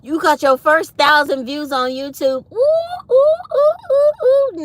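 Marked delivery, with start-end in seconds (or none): 2.5-4.7 high squealing ooh ooh ooh; excited cooing. Real, not acted. Thrilled host cooing over her viewer's first thousand views. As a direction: coo with delight at the news, high up.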